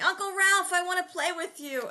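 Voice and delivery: put-on high, pleading little-kid voice; little Mark. Nephew pleading with his tired uncle, high kid voice. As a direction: beg like a five-year-old.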